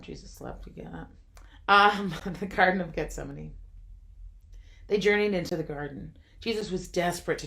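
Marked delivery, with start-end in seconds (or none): 0.0-1.1 whispering. Real, not acted. Repeating the joke in an aside.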